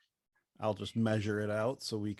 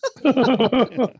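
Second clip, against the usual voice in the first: high burst of real laughter; loud high laugh at the Scottish joke; 12 semitones higher, 11 dB louder than usual. Real, not acted. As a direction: break into a big cackle.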